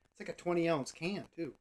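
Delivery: usual speaking voice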